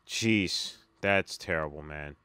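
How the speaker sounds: ordinary talk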